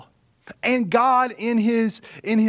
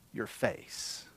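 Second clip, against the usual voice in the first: voiceless whisper, much softer; 11 semitones lower, 16 dB quieter than usual. Acted. Preacher whispering Peter's words to the congregation. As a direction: hush the words like a secret. Quote ends in a hush.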